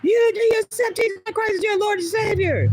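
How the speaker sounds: high-pitched mock yell of a zealot; imitated yelling. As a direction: yell it like someone accosting a stranger. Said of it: "just yelled in my face".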